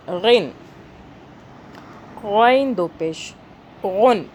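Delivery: غین and غن with a voiced GH from the throat, drawn out; throaty ghain. Shown as a demonstration. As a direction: roll each GH of غن from the back of the throat. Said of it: "یہ بھی حلق سے آئے گا". (غین حلق سے، کھینچ کر)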